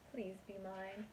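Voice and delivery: usual speaking voice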